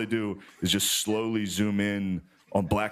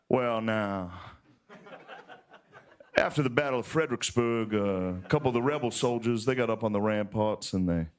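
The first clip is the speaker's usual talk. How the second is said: put-on leisurely old Southern historian; old Southern storyteller. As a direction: spin a yarn in a Southern accent, with long pauses. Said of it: "this old Southern guy".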